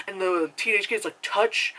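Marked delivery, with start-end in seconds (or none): 1.2-1.7 "Touch" pitched high, talking down; mock condescending. Teenage kid echoing the lady's accent, condescending. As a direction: echo "Touch" with mocking condescension. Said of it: "like, being so condescending".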